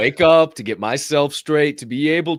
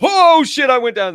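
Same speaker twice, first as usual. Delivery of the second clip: loud, high shout falling away; mock startled whoop; roller-coaster yell; 13 semitones higher, 11 dB louder than usual. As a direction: yell it as if plunging downhill. Acts out roller coaster drop.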